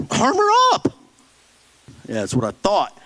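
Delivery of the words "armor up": much higher, squeaked cliché; mock squeaky answer. Pastor mocking a clueless believer, squeaked answer. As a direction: squeak out the hollow reply. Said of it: "how do you do that".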